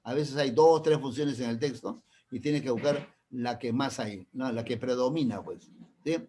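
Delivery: normal talking voice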